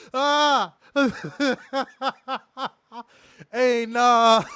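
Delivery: loud, high burst of laughter; real hysterical laughter; laughing fit. Real, not acted. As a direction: crack up helplessly.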